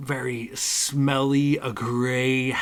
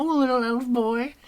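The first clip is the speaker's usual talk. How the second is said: put-on little elf boy rising into a very high, teasing hag squeal; little elf boy sung up high, like a monster toying with her prey; mocking hag voice; 13 semitones higher, 3 dB louder than usual. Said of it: "looks at you and says".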